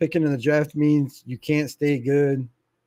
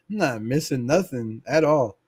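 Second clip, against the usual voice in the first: ordinary talk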